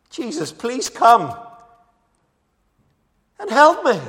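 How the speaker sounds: rising to a high, loud cry on help me; desperate plea. Retold or imitated. Father crying out to Jesus, desperate rising plea. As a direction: beg for help, voice climbing. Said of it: "he pleaded earnestly with Jesus".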